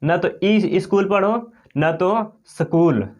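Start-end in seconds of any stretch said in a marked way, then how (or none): 0.4-1.1 "ई स्कूल" said with an extra i sound before the s, like i-skool; "ई स्कूल" as eeskool, the common mistake; wrong pronunciation. Shown as a demonstration. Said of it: "कई लोग ऐसे कहते हैं". 2.5-3.1 slow "स्कूल" split as sa-kool with a vowel between s and k; "स्कूल" as sakool, slowly; wrong pronunciation. Shown as a demonstration. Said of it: "स्कूल भी नहीं पढ़ सकते हैं".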